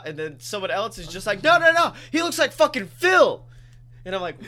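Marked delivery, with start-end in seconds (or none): 1.4-3.4 loud, high insisting coworker; mock coworker shout. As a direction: overrule everyone with a yell. Coworker insisting loudly, retold.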